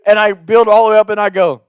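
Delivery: ordinary delivery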